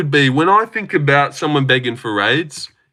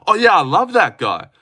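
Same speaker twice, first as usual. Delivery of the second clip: higher, put-on supportive gush; mock enthusiasm; 5 semitones higher than usual. Streamer showing a member how to back him, mock-enthusiastic. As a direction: play the loyal fan, pitched up. Models the loyal reply wanted.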